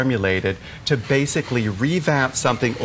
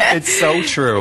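words through a laugh; 4 semitones higher, 4 dB louder, slower than usual. Real, not acted. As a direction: still laughing as you agree. Laughing after the joke.

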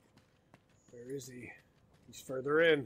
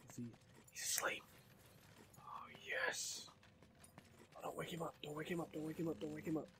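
hushed whisper, barely voiced; whispering over a crib; 15 dB quieter than usual. Real, not acted. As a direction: whisper so he won't wake up. Sneaking up on sleeping monster.

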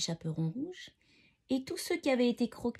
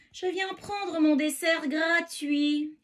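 high, slow, drawn-out wolf call; roaring wolf; 6 semitones higher, 3 dB louder, slower than usual. A narrator's reading. Wolf arriving at the clearing for his prey, roaring. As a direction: roar it out slowly, relishing each word. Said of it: "rugit le loup".

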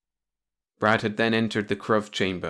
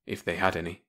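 usual speaking voice

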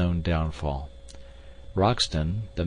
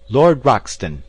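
loud, higher call to someone far off; shouting; 5 semitones higher, 8 dB louder than usual. A narrator's reading. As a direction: hail him across a chasm. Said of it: "he shouted".

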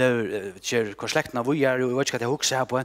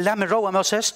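God's demand, higher and louder; stern God; 8 semitones higher, 6 dB louder than usual. Acted. Preacher voicing God demanding Moses step aside, stern. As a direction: press it as God's command.

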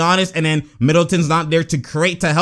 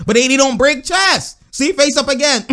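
much higher, emphatic commentary; excited insistence; 8 semitones higher than usual. Real, not acted. Commentator seeing his point proven on screen, excited insistence. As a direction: drive the point home, pitched high.

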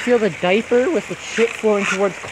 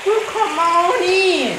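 teasing sing-song invite; 8 semitones higher, slower than usual. Acted. Coaxing friend again.

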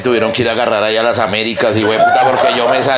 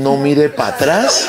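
drawn-out warning; 5 semitones higher, much slower than usual. Retold or imitated. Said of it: "a uno no es más sino que le digan".

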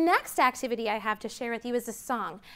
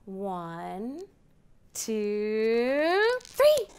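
building countdown; much slower than usual. Acted. Teacher counting to children, building to an excited three.

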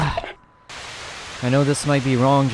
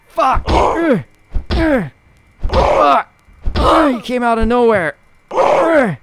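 high frightened cursing; startled panic; 10 semitones higher, slower than usual. Real, not acted. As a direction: yelp in fright while under attack. Jump scare attack.

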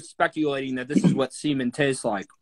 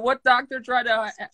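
normal talking voice

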